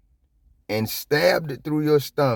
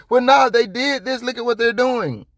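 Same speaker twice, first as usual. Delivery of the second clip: mocking the authorities; 12 semitones higher, 6 dB louder than usual. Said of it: "So they can say".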